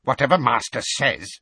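loud, high resentful elf; mock obedience through anger; seething servant's grumble; furious muttering. A narrator's reading. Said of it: "he muttered furiously".

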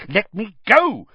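high, loud snarl. A narrator's reading. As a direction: pull away, snarling. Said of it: "Harry snarled".

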